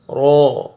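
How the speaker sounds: رو loud, full and rounded; heavy raa. Shown as a demonstration. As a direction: say رو thick, never thin. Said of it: "رو کو موٹا کر کے پڑھنا ہے". (موٹا کر کے رو)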